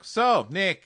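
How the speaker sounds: lightly hammed-up bossy sister, loud and high. Sister confronting Nick about the relationship, bossy. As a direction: open the interrogation high and loud.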